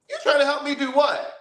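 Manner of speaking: high indignant retort; incredulous. Retold or imitated. She mocks the caller's offer to help, high and indignant. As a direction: throw his words back at him.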